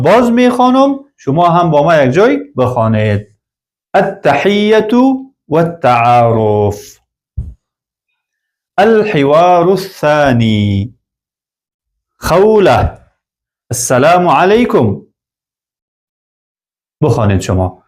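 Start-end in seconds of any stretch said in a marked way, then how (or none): 3.9-15.0 slow, careful lesson recitation of an Arabic dialogue; slow model reading. Shown as a demonstration. Teacher reading a model dialogue to learners, slow and careful. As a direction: read each line slowly for students to copy.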